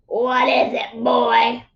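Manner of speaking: put-on a lightly croaked old-witch voice; hint of a spooky old crone; croaking witch. A narrator's reading. Old witch answers the boy at her door, lightly croaking. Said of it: "she croaked".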